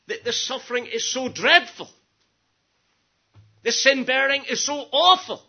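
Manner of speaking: high impassioned lament; anguished plea. Acted. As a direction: plead in dread of the suffering. Acting Christ's plea over suffering.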